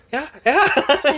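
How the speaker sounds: high, loud rapid yeses; eager mock yes. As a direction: imitate an overexcited bride saying yes. Imitating her eager yes to proposal.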